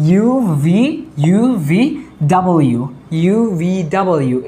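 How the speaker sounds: "ইউ ভি ডাবল ইউ" with English v and full double-you; letter recitation. Shown as a demonstration. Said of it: "এভাবে". ("ইউ ভি ডাবল ইউ" স্পষ্ট ইংরেজি উচ্চারণে)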